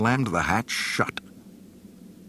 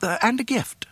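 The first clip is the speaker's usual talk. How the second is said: fussy droid. A narrator's reading. C-3PO adds nervously.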